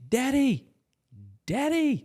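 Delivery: put-on high-pitched kid calling for Dad; pestering child. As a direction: imitate a kid's piping, insistent call. Kids interrupt dad's golf shot.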